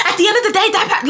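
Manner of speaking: high-pitched mock yelling; imitated screaming. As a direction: mimic an angry neighbor going off. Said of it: "she starts screaming at me".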